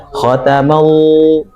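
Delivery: "khatamal-" slowly drawn out into a held L; lam tebal. Shown as a demonstration. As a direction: stretch the end of "khatamal-" on a heavy L. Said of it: "udah mengucapkan huruf Allah". ("khatamal-" lambat dengan lam ditahan)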